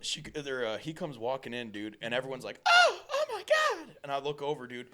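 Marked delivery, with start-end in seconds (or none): 2.7-3.9 very high, loud, slow shocked "oh my God"; shocked gasp. Retold or imitated. Everyone shocked at bleeding man.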